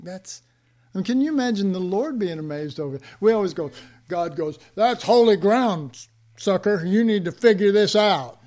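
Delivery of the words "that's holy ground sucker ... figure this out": booming, higher-pitched voice of God scolding; loud mock rebuke. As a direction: call it out loud, playing God telling someone off. Preacher voices God's loud rebuke.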